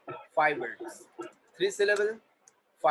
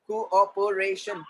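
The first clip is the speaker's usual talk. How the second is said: very slow, separated syllables; slower than usual. Shown as a demonstration. Teacher modelling syllables for the class, syllable by syllable. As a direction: break the word into slow beats.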